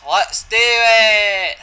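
high, long drawn-out yell; loud, slow, high battle cry; rallying shout. Real, not acted. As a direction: belt it out, stretching the last word.

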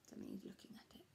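soft whispered aside; whispered explanation. Real, not acted. Reader explains word to children.

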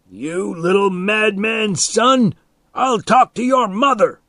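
high, loud angry threat; furious yell. A narrator's reading. Man threatens to tell on boy, yelling angrily.

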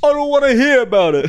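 high, loud mock chief voice; mock police chief. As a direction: shout it down like a cartoon boss.

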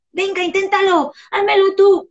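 put-on high and loud, a child pushing you to do it; demanding child. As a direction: beg the parent to do it. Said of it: "child demanding help". (niño exigente, agudo y fuerte)

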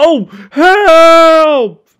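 loud high frantic yelling; high loud desperate shouts; panicked cries for help. Acted. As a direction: scream for rescue in panic. Gordon still dangling.

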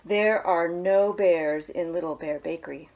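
ordinary delivery, nothing marked

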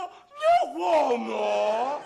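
very slow, laughing catchphrase; slow punchline. Acted. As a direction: stretch it to the limit.

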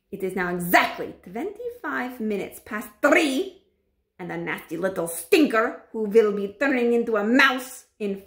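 put-on witch voice, gloating; menacing witch voice. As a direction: announce it like a scheming witch queen. Grand High Witch voice held.

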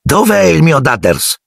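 high, hammed-up bellow of a big aunt; high mock roar of a bossy aunt; Aunt Marge roaring. A narrator's reading. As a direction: boom it out as a pushy aunt, pitched high. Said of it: "ruggì zia Marge".

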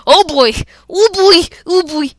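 loud, high yelps of alarm; panicked. Real, not acted. Gamer facing sketchy guys, panicked high yelps. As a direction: yelp it over and over, high and loud.

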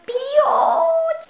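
very high squeal of delight; cute squeal. Real, not acted. Vlogger squeals over cute eraser, high delighted squeal. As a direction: squeal it like it's adorable.

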